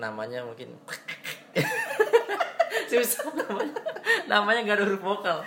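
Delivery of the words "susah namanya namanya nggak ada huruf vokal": high-pitched, laughing all through the words. Real, not acted. Host joking with co-host about unpronounceable names, laughing. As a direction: giggle helplessly while explaining.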